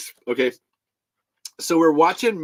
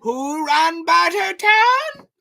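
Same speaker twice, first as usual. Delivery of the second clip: high, slow bellowed demand; shouted movie quote; 6 dB louder, much slower than usual. Acted. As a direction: bellow it slowly like a film villain. Shouting Mad Max film line.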